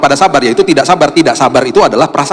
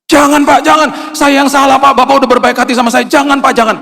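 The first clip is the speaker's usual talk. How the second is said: pleading courier; 12 semitones higher than usual. Retold or imitated. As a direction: beg him not to, high and urgent. Courier refusing the money. (menolak dengan memelas, suara tinggi dan keras)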